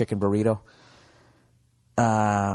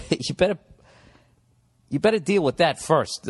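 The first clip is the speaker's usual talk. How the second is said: mock warning; 5 semitones higher than usual. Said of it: "And it's like".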